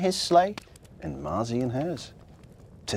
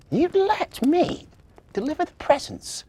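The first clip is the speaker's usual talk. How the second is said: hopeful little elf; 13 semitones higher, 3 dB louder than usual. A narrator's reading. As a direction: ask it like a small elf who can't believe it. Marzi's hopeful question.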